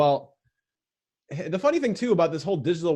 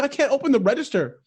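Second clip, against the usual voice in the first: high, whiny barista voice; helpless barista; 9 semitones higher than usual. Retold or imitated. Barista complaining to customer she cannot open the register, high whine. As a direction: mimic her helpless whine. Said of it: "She's like".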